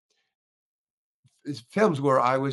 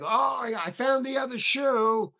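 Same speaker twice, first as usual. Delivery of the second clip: mock excited shout; 10 semitones higher than usual. Actor playing the shoe scene on set, hammed-up excited shout. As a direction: burst out like you just found the thing.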